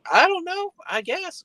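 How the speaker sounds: very high, exasperated imitation; flustered uncertainty. Shaken friend answering driver, high flustered voice.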